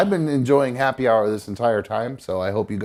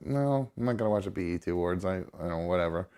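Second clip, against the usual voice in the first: softer, quick brush-off of mom; mumbling no to his mother; dismissive brush-off; 5 semitones lower, 9 dB quieter, faster than usual. Retold or imitated. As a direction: shrug the question off under his breath. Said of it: "And I was like".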